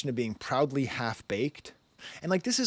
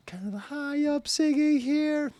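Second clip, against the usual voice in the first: much higher, slow falsetto singing. Shown as a demonstration. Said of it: "A little [name] singing too".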